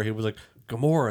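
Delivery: ordinary talk, nothing marked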